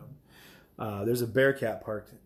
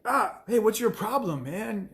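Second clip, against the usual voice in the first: mock protester outrage; 11 semitones higher, 4 dB louder, slower than usual. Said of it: "when they're like".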